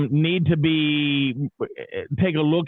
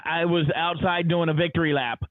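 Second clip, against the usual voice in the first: ordinary delivery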